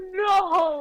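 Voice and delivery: sudden high shouted no. Real, not acted. Friend hears the theory and shouts in dismay. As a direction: blurt it out in dismay.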